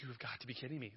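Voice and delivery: a father whispers to himself at the empty parking lot in disbelief; disbelieving whisper. Retold or imitated. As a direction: breathe it out in disbelief.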